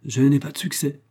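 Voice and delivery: plain reading voice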